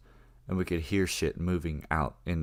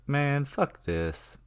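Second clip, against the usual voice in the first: slow, much higher "fuck this" in disgust; fed up; 10 semitones higher, 4 dB louder, much slower than usual. Retold or imitated. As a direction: drag it out, done with it all. Reese fed up, heads out.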